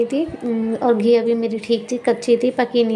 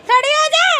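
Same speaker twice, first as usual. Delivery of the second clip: mother nagging her daughter to get up and do homework; high-pitched scolding; 10 semitones higher than usual. Real, not acted. Mother scolding small kids.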